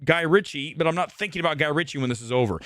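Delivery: ordinary delivery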